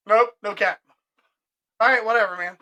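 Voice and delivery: ordinary talk, nothing marked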